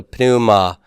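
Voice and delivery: slow "pneuma" with the P sounded; "pneuma" with the P pronounced, not dropped as in English. Shown as a demonstration. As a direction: say "pneuma" starting with a clear P before the N. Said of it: "we're gonna pronounce that P".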